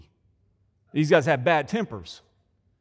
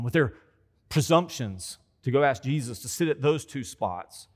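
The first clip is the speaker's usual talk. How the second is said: ordinary talk, nothing marked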